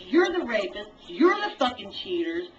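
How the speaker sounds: usual speaking voice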